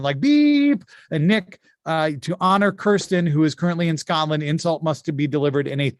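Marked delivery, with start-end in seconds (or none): 0.2-0.8 high, loud, drawn-out beep; long censor beep. Shown as a demonstration. Host shows the player how to bleep swearing, long high beep. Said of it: "like, beeping it out".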